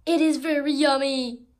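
a gleeful loud cheer; loud exclamation. Acted. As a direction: sell the yumminess loudly. Character exclaims.

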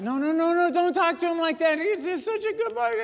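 high, pleading mother voice; protective mom. Retold or imitated. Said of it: "the mom's like".

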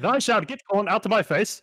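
high, hammed-up olde-English proclamation; mock archaic settler. Settler grandly refusing the corn, mock archaic proclamation. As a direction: declaim it like a pompous pilgrim.

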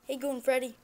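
normal talking voice

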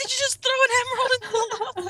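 fast, laughing disbelief; surprised. Retold or imitated. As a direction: rush it out in shocked laughter. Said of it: "I was like".

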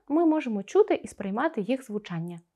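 normal talking voice

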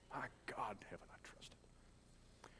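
very soft, slow whisper; real emotion, hushed; whispered testimony. Real, not acted. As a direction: hold back tears and whisper it.

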